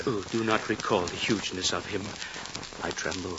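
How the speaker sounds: hushed, sinking into a low murmur; feigned dread. Acted. Mayor feigns fear of tiger.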